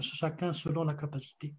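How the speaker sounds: usual speaking voice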